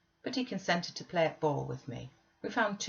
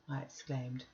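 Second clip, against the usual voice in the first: ordinary delivery, nothing marked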